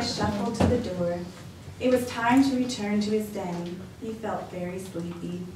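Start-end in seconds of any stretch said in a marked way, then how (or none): none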